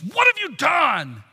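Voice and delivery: slow, drawn-out accusing yell; acted exasperated outburst; angry yell. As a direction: yell it at the accused, stretching the words. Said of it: "Pilate yells at Jesus".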